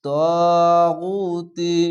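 তাগুতি with তা and গু each held one alif; long vowels held. Shown as a demonstration. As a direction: draw out তাগুতি slowly and fully. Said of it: "এক আলিফ টেনে পড়বেন". (তাগুতি টেনে ধীরে পড়া)

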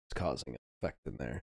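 usual speaking voice

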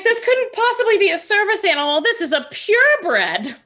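high, breathless swooning admiration; gushing noble. Acted. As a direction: swoon over the purebred dog. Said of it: "She's like".

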